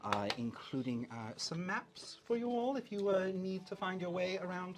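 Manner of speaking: halting, stammering posh rabbit with polite hesitations; flustered posh manager. Acted. Hotel manager correcting and welcoming guests, flustered posh voice. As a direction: politely cover annoyance in a posh accent. Game master voices Mister Zenith.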